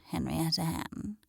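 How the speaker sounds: a disdainful whispered aside. Acted. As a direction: whisper the name Henrietta Hen. Hen whispers the name.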